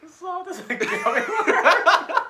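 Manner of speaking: high, loud, slow words breaking into laughter; real uncontrollable laughing fit; helpless laughter. Real, not acted. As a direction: squeal it out while cracking up. Cracking up while repeating the line.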